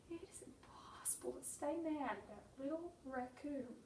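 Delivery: soft whispered fondness; whispering. Real, not acted. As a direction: whisper it tenderly. Fond whispered confession.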